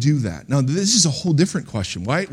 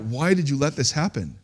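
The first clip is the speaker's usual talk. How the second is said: ordinary talk, nothing marked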